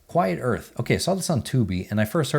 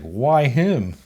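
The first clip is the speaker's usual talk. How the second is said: mock-indignant father, a bit louder; disgruntled dad; 3 semitones higher, 4 dB louder than usual. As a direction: toss off the dad's grumpy objection. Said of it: "and he's like".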